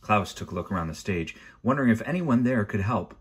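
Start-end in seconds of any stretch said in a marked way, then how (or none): none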